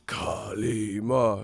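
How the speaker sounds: slow, low ritual incantation; Temple of Doom priest. Retold or imitated. Streamer retells the heart-ripping scene, mock priest chant. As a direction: intone it like a movie cult priest. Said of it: "He said".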